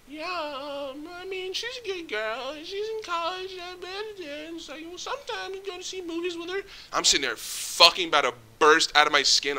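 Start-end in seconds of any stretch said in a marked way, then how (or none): 0.1-6.6 put-on chatty old lady. Imitating the old lady chatting to his manager, high and soft. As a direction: put on a sweet rambling grandma voice. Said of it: "She's like".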